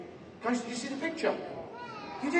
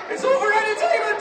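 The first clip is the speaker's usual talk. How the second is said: loud, high-pitched protest; high strained holler; pained comic shout; 14 semitones higher, 7 dB louder than usual. Pretend. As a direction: shout it through mock agony. Protesting comically after being hit.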